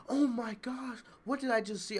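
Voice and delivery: high, mock-shocked crowd member; mock shocked gasp. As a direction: ham up a gasping, shocked fan. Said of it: "acting all shocked, like".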